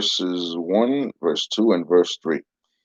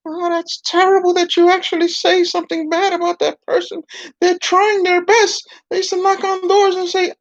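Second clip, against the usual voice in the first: higher preachy puppet; a prim dummy chiding. Acted. Puppet scolds, recalls phrase.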